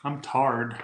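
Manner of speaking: Southern drawl, "tarred" for tired; Southern accent. Shown as a demonstration. Showing viewers the Southern way to say tired. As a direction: say "I'm tarred" the way the South says it. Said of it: "That's how they say it in the South".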